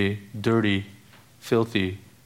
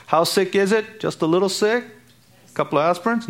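mock question; 9 semitones higher than usual. Mocking rhetorical questions.

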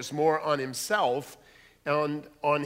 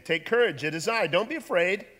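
raised, higher reassuring call; 5 semitones higher, 5 dB louder than usual. Acted. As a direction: call out loudly over a distance. Jesus calling to frightened disciples.

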